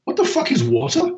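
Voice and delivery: very high squeaky bewildered voice; baffled young fish. Acted. Young fish baffled by the old fish's question, high squeaky voice. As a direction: squeak it out, totally confused. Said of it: "look at each other and go".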